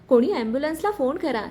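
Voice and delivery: usual speaking voice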